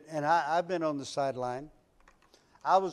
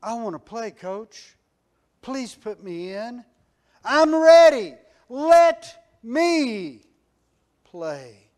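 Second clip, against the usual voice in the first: pleading player; 5 semitones higher, 13 dB louder, slower than usual. Retold or imitated. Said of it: "very few who would say".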